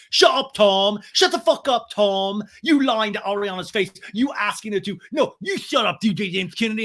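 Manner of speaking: high, loud angry imitation; mock shouting. James shouting down Tom, high mock yelling. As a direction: shout them down in a mimicked rant. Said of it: "And James like".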